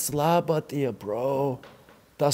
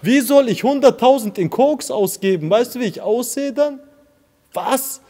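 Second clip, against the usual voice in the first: indignant; 6 semitones higher, 5 dB louder than usual. Real, not acted. Indignant rebuttal about cocaine accusation.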